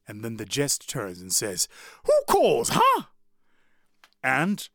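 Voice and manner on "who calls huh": jester calling back. Retold or imitated. Jester answering a yell.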